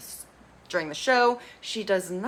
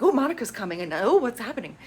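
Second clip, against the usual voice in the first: high, hammed-up fretting; mock panic; 4 semitones higher, faster than usual. [name] going over to Josh, mock panicked. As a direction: imitate someone flustered, pitch swooping up.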